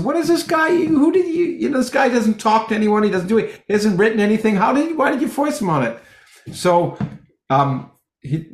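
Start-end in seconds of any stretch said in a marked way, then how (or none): 0.0-6.0 much higher, puzzled complaining voice; playing Bohr baffled by his silent student; high, indignant letter voice; exasperated Bohr. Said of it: "Bohr wrote back to England and said".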